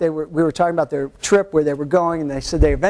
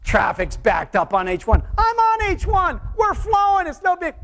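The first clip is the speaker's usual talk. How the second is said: mock outraged driver; 11 semitones higher than usual. Driver on the freeway scoffing at the traffic report, hammed-up indignant yell. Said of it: "driving down the freeway and going".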